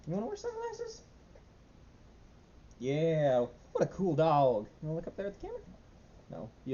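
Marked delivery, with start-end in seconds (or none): 0.1-4.7 very high sing-song voice for the dog; pet talk. Real, not acted. Talking sweetly to the dog. 4.8-5.6 high coaxing voice to the dog; pet talk. Real, not acted.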